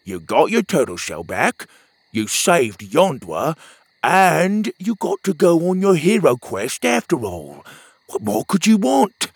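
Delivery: lightly voiced playful sidekick; a hint of a cheeky companion; teasing friend voice. A narrator's reading. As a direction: rib your friend about his snoring. Petey voice.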